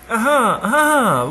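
the father cries out in delight; exclaiming laugh. A narrator's reading. The father greets his daughter and grandson, exclaiming delightedly. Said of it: "en s'écriant".

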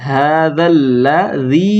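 হাযাল্লাযী slow, long ha, doubled l; হাযাল্লাযী chanted slowly with the ll doubled; drawn-out tajweed. Shown as a demonstration. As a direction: stretch হাযাল্লাযী, holding the ll. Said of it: "এখানে টান".

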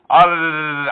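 sustained tongue trill; rolled R attempt. Shown as a demonstration. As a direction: try hard to roll a long R. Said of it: "I need help rolling the R's".

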